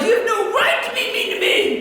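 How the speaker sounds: high-pitched mock outrage; hammed-up, indignant high voice; upset protest. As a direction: wail it like an indignant kid. Acts out upset child protesting.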